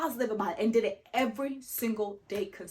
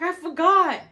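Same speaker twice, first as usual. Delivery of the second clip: mom's excuse; 7 semitones higher, 8 dB louder than usual. Retold or imitated. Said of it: "she's like".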